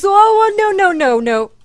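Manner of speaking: very high, loud string of no; shrieking as the creeper blows; panicked yell. Real, not acted. Creeper appears at the grave.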